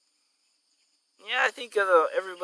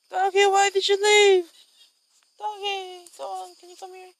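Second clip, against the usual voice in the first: high pleading dog call; 5 dB louder than usual. Pretend.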